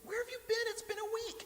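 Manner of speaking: high, surprised squeal. Real, not acted. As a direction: act amazed he's finally back. Host surprised by Noah's return.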